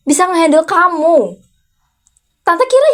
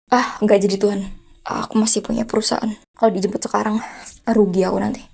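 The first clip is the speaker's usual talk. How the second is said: muttered aside; 5 semitones lower, 7 dB quieter than usual. Acted. Comic aside to God.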